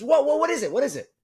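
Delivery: jolted awake, he yelps at the attendant; startled awake. Retold or imitated. Startled, confused yelp. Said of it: "He says".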